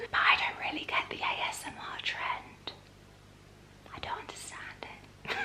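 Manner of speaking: teasing ASMR whisper, voice barely there. Pretend. Whispering to viewers that she doesn't get ASMR. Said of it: "Oh, this is like A S M R".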